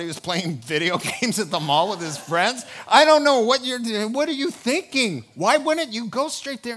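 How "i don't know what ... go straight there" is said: higher and louder, incredulous; exasperated outburst. Real, not acted. Preacher scolding Mary and Joseph in mock exasperation. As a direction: throw the question at them, baffled.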